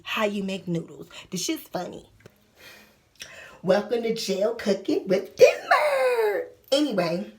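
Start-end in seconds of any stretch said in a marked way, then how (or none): 3.6-6.5 laughing, slow show intro; playful host voice with a laugh; mock cooking show host. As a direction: announce it like a TV host.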